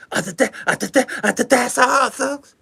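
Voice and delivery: a hint of cartoon pig stammering; Porky Pig stutter. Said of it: "You stuttering like Porky Pig".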